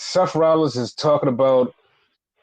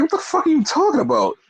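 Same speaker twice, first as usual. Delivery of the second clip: fast, high mock incredulity; retold disbelieving comeback; Seth Rollins; 10 semitones higher, faster than usual. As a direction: imitate a quick, baffled retort, voice up. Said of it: "He's like".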